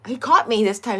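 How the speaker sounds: ordinary talk, nothing marked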